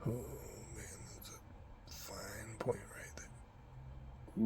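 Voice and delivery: slow whisper on a held breath; hushed, breath held, drawn out; holding breath. Real, not acted. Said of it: "can't talk while I'm holding my breath".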